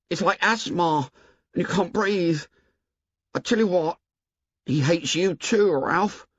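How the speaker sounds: put-on high, anxious boy's voice; fretful, insistent Piggy; worried plump boy pleading. A narrator's reading.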